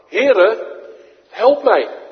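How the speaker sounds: loud, high cry of a drowning man; desperate cry for help. Acted. Peter sinking in the waves, crying loud and high for help. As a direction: call out to the Lord in panic.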